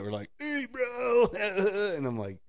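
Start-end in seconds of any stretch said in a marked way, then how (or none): 0.4-1.3 high-pitched mock bro greeting; youth dude. Man mocking youth leaders he grew up with, high bro voice. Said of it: "frosted tip haired youth dudes". 1.3-1.9 high, goofy mimicked laugh; mock dude laugh. Man mocking youth leaders he was used to, high fake laugh.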